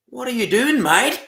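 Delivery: high-pitched mock Australian questioning; exaggerated Aussie "mate"; Australian accent. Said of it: "what is it he says?".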